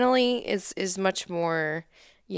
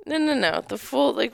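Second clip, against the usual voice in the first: ordinary talk, nothing marked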